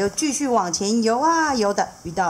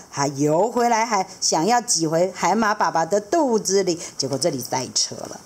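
ordinary delivery, nothing marked

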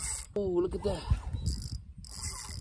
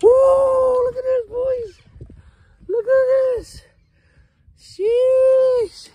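high, loud triumphant cheer; excited shout; 13 semitones higher, 4 dB louder, much slower than usual. Real, not acted. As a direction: crow over the catch. Showing off the caught fish.

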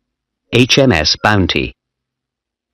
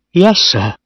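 put-on high-pitched, nervous boy; a timid, higher-pitched reply; afraid; 8 semitones higher than usual. A narrator's reading. Young officer answering his stern captain, afraid.

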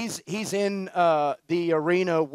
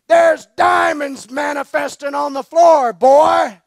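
loud, higher and slow, a big excited announcement; imitating Hogan; 7 semitones higher, 11 dB louder, slower than usual. Retelling the preacher's words at worship, loud and slow. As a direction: boom it out like the burly preacher spotting treasure. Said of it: "That's exactly how he said it".

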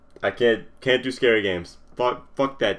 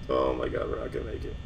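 low murmur fading into whisper; soft, fearful whisper; hushed despair; 10 semitones lower, 7 dB quieter, faster than usual. Real, not acted. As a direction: whisper it as hope runs out. Despairing near end of night.

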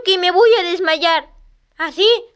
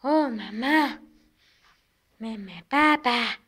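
deep mock bleat; 10 semitones lower, 10 dB quieter, slower than usual.

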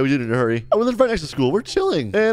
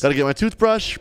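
ordinary talk

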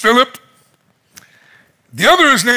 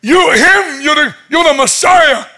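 high, overwhelmed shout; losing his mind; 8 semitones higher, 4 dB louder than usual. Retold or imitated. Nathaniel falling down before Christ, losing his mind. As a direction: blurt it out, stumbling over words. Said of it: "losing his mind and falling down".

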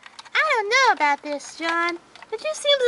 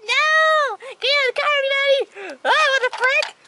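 urgent shouting; 6 semitones higher than usual. Acted. Ordering everyone out of car.

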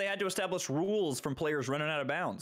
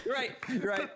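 big laugh; 3 dB louder than usual. Real, not acted. Sudden realisation laugh.

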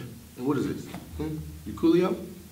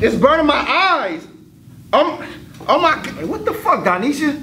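disgusted outcry; 7 dB louder than usual. Acted. Reacting to a stink in class.

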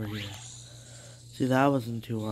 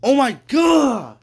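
high, loud shocked shriek; scared scream; 13 semitones higher, 13 dB louder, slower than usual. Real, not acted. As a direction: scream in sudden fright. Jump scare in horror game.

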